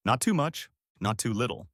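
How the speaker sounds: plain reading voice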